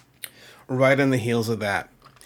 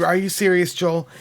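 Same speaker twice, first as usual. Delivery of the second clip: high, louder incredulous question; pitched-up "you can't mean it" retort; disbelief; 7 semitones higher, 6 dB louder than usual. Retold or imitated. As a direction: challenge a friend in retold disbelief. Retells his disbelief at friend.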